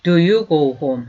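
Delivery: "Do you go home?" said slowly as one unit; "Do you go home" as a single chunk; second chunk. Shown as a demonstration. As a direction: join it into one block. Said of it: "한 덩어리".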